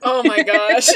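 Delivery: loud laughing exclamation. Real, not acted. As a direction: burst out laughing. Laughs at joke request.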